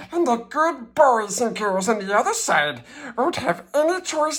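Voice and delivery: high, tearful begging toys; pleading cry. A narrator's reading. Toys beg the little blue engine, high and tearful. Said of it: "cried the dolls and toys".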